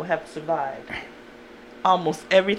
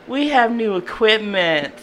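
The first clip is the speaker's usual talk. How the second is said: ordinary delivery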